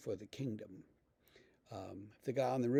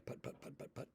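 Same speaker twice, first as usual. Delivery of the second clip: motorboat putter; 14 dB quieter, faster than usual. Retold or imitated. Imitating a motorboat engine.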